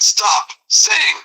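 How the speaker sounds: high sharp stop saying; aggravated. Acted. Aggravated man snapping, very high. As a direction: cut it off high. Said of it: "beyond aggravated".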